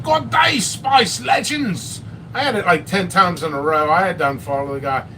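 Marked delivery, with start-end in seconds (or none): 0.0-2.0 high, drawn-out Aussie greeting; mock Australian shout of good day; Australian accent. Mocking the Australian reviewer's greeting, in an Aussie accent. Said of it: "that Australian".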